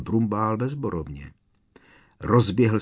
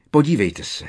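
agitated; 7 semitones higher, 6 dB louder than usual. Acted. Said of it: "řekl rozčileně".

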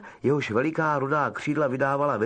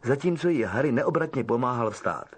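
plain reading voice

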